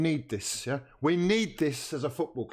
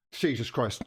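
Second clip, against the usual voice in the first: ordinary delivery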